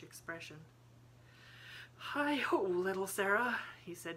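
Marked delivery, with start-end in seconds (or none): 2.0-3.6 sighing out words to himself; a touch of fatherly wistfulness; wistful sigh. A narrator's reading. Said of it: "He drew a big sigh".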